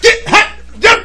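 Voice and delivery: high, loud chopped-off syllables; unfinished-word dad yelling. Retold or imitated. Dad too mad to finish words, chopped yelling. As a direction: yell broken bits of words in mock dad mode. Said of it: "I don't finish words".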